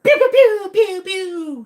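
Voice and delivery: high, loud playful gun sounds; pew pew gun noises. Pretend. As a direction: fire off toy laser shots. Mock gunfire at the pet sloth.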